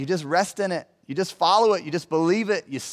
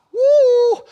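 high, loud whoop of amazement; excited whoop; 8 dB louder than usual. Real, not acted. Preacher reacting to the five husbands, high loud whoop. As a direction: let out a big whoop.

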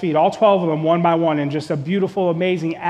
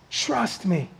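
Trust me, much softer and hushed; soft plea; 7 dB quieter than usual. Acted. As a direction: lean in close and almost breathe it. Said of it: "intimate plea, far softer".

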